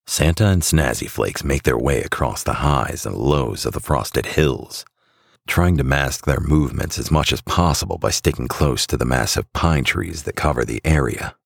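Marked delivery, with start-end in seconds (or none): none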